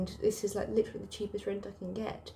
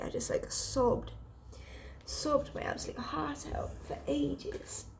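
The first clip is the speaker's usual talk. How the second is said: higher, slower, on the edge of tears; tearful; 7 semitones higher, 4 dB quieter than usual. Real, not acted. Confessing to viewers she sobbed, tearful and breaking. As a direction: let the voice break as you say it. Tearful while recalling sobbing.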